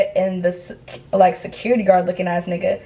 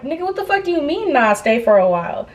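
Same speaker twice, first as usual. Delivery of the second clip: quick, high-pitched outburst settling down; acted-out affronted questioning; indignant disbelief; 6 semitones higher than usual. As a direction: throw the phrase back incredulously. Indignantly repeats the man's refusal.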